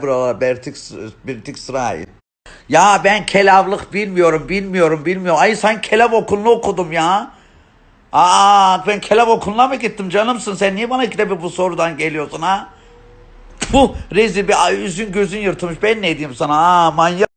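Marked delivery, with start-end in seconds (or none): 2.7-7.3 high, loud protesting; exasperated rant. Acted. Pestered by a viewer's repeated question, exasperated high rant. 8.1-12.7 high, loud telling-off; exasperated scolding. Acted. Telling off a viewer for asking twice, exasperated and high. 13.7-17.3 loud, high berating; indignant insults. Acted. Cursing a pestering viewer, indignant and loud.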